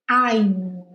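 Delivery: "I'm" ending on a closed-lip M, lips pressed shut; English I'm, lips closed. Shown as a demonstration. Teacher showing students "I'm" with lips together. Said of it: "encosta os lábios".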